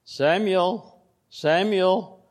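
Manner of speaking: high, drawn-out call of a name; calling voice of God. Acted. The Lord calling young Samuel at night, high drawn-out call. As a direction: call the boy's name from afar.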